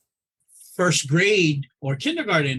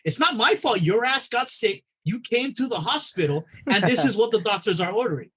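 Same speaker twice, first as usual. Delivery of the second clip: scolding patient; 7 semitones higher than usual. Retold or imitated.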